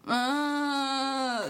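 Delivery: put-on long, high drunken whine. Drunk girl on the phone, a whining groan.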